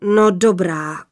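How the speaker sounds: slow, lightly voiced huge father; a touch of weary rumble; giant's sigh. A narrator's reading. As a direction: sigh it out slowly, giving in. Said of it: "vzdychl hrozitánský hlas".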